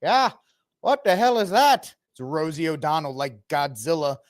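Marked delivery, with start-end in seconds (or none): 0.0-1.9 high, alarmed local voice; suddenly spot the monster; startled villager. Pretend. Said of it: "Isn't that right, Dylan?".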